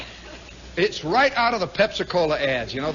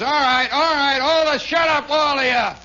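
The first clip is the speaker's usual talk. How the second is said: louder, higher cop shouting everyone down; cop's shout; 5 semitones higher, 4 dB louder than usual. Retold or imitated. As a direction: bellow to silence the honking crowd. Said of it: "The cop finally says".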